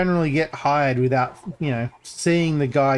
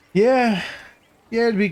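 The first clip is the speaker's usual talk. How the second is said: excited yeah; 9 semitones higher than usual. Real, not acted. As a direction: blurt it out with a jolt. Sudden emphatic yeah.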